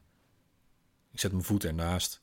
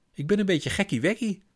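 very high, forced jolly "gekkie-wekkie"; forced laughing; 13 semitones higher than usual. Acted. Mother tries to laugh it off to her daughter, very high and forced. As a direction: try to laugh it off. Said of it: "probeert moeder te lachen".

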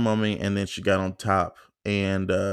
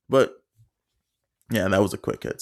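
ordinary talk

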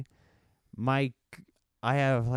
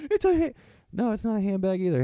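much higher, mock-prissy correction; mock high protest; 10 semitones higher, faster than usual. Joking about man purse.

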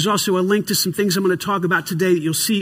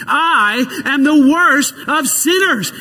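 believing declaration; 5 semitones higher, slower than usual. Shown as a demonstration. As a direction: proclaim it like you believe it. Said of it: "Can you say it again and believe it?".

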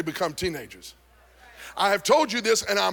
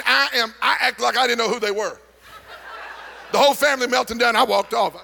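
usual speaking voice

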